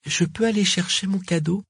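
small, high-pitched question; timid child. A narrator's reading. Corentin asking Père Noël for his present, timid. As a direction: ask shyly in a little high voice. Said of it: "demanda timidement Corentin".

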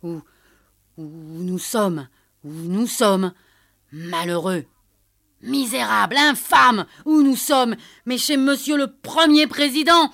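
building from stammer to high, very loud rage; stammering, escalating fury; furious sputtering. A narrator's reading. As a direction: choke on fury, then explode. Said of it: "saisi soudain d'une colère folle, balbutia".

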